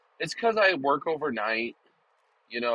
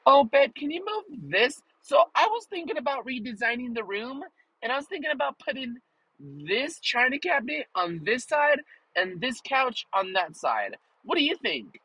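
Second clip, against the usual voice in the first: much higher, sweet nagging wife voice; mock wife voice; 11 semitones higher than usual. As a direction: put on a high wife's voice asking for a favour. Wife voiced asking to move furniture.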